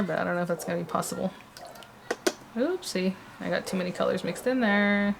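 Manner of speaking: quiet at night. Real, not acted. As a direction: keep it down, late at night. Late night crafting, girl asleep.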